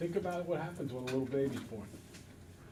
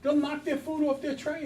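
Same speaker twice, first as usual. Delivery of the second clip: usual speaking voice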